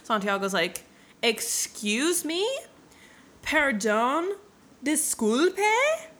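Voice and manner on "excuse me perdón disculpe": high, loud questions with exaggerated rising ends; mock incredulity. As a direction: stretch each word into a bigger disbelieving squeak. Hero balks at old man's claim.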